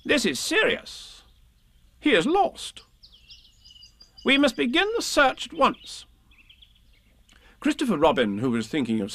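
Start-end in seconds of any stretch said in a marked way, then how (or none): 0.0-6.0 high, grave pronouncement; pompous rabbit taking charge; self-important Rabbit. Acted. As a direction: announce it with great importance, pitched high. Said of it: "he looked very important".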